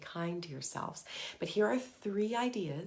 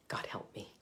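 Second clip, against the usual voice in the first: whispered "God help me" under the breath; under your breath; 8 dB quieter than usual. Shown as a demonstration. To stressed moms, a whispered prayer. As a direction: breathe the words out unvoiced. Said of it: "you can say it under your breath".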